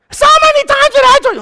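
shrill-high, loud, fast scolding; exasperated wife. Retold or imitated. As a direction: blow up at him like a fed-up wife.

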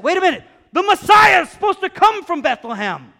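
high, loud shout of astonishment; hammed-up alarmed exclamation; startled realization. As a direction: cry out as it suddenly dawns on you. Pharisees' imagined startled realization.

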